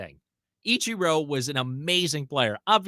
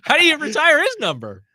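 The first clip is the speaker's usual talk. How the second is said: incredulous; 11 semitones higher, 7 dB louder than usual. Real, not acted. Incredulous question.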